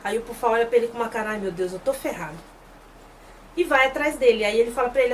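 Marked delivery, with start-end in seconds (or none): 1.3-2.4 low, soft muttered dismay; dismayed aside. Retold or imitated. The chief thinking he is in trouble, muted dismay. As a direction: mutter it like a sinking thought.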